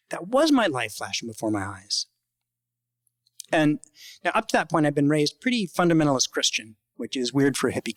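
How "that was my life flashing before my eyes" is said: emphatic, stretched "was" in a retold realization; stress on WAS. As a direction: lean hard on "was" with a swooping rise and fall. Realization reverses his earlier denial.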